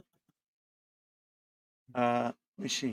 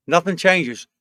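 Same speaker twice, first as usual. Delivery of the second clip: mock Jordan rant; 6 semitones higher, 5 dB louder than usual.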